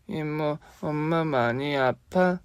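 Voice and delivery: put-on small child's high, slow worried question. Little boy asks his aunt about his mother, high and slow.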